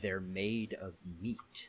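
soft, low, drawn-out MEAT; slow deadpan repetition. Retold or imitated. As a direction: repeat it very slowly, stressing each word in deadpan. Repeats film line after "What?.

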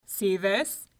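loud giant voice; boastful giant. A narrator's reading. Giant showing his trophy to Una, boastful. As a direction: show off loudly like a bully. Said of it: "bellowed the giant at the door".

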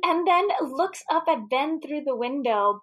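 normal talking voice

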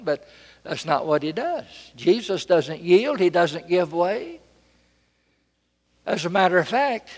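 usual speaking voice

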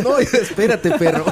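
excited. Retold or imitated. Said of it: "le dicen".

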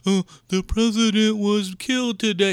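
a hint of a dopey read-aloud voice; mocking a screen reader. Mocking the moviegoer reading headlines aloud, lightly dopey. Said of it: "reads everything that's on".

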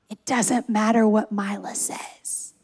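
slow, low near-whisper; hushed reassurance. Retold or imitated. Dad retelling how he calmed his crying son, hushed and steady. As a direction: whisper it slowly, as if calming a child.

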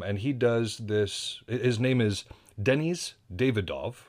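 normal talking voice

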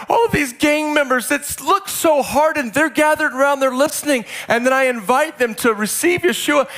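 high, ringing excited preaching; excited amazement. Real, not acted. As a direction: pour out the wonder at a high pitch. Preacher relives an amazing response.

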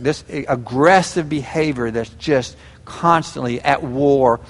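normal talking voice

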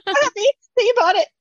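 very high, teary and breathless with joy; gushing tearful squeal. Retold or imitated. Author to the deck's creator, squealing tearfully with joy. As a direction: squeal it like a starstruck fan near tears. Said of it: "And I was just like".

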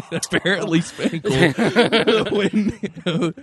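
talking through laughter, high pitched; laughing. Real, not acted. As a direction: crack up while trying to speak. Co-host cracking up at the sound.

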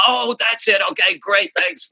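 a bright mock shout of recognition; imitating Mike. Bandmate suddenly remembering the part: high mock shout. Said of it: "Mike was like".

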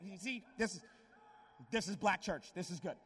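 ordinary talk

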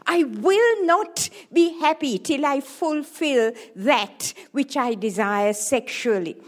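normal talking voice

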